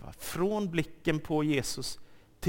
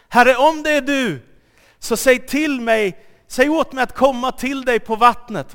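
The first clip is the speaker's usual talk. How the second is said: high, loud call across the water; eager call; 8 semitones higher, 10 dB louder than usual. Acted. Peter calls out from the boat to Jesus, loud and high. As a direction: call out boldly to him.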